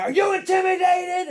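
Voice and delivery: high, loud yelled challenge; screaming madman impression. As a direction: scream it like an unhinged motivational speaker. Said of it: "he was screaming and yelling".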